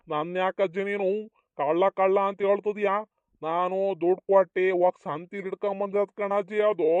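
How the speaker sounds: ordinary delivery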